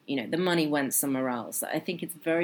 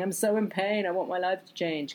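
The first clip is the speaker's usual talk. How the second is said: higher mock cry of pain, not really screamed; hammed-up pained wail; 5 semitones higher than usual. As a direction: overdo the self-pity, pitched up. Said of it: "screaming and shouting and going".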